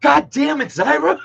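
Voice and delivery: exasperated laugh. Real, not acted. Teacher reacts to a former student's joke, high laughing exasperation. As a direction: caught off guard, laugh-cursing the name.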